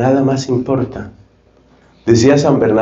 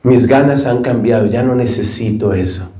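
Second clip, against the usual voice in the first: normal talking voice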